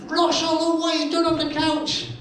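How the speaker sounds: high-pitched mock mum; lightly voiced outraged mum; mum complaining.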